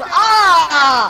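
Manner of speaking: high, loud mock scream; panicked scream. Caught-out fake Russian answering where they're from, mock scream. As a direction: break off into a comic wail of panic.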